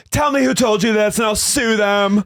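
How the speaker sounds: high-pitched mock outburst; hammed-up flipping out; retold outburst. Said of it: "she starts screaming".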